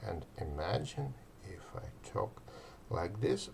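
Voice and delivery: soft, settled, even delivery; calm them down gently; slightly calm. Shown as a demonstration. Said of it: "but not overly calm".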